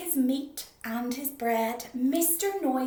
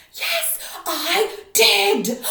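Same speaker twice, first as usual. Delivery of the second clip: loud slow reply; 10 semitones higher, 15 dB louder, much slower than usual. Acted.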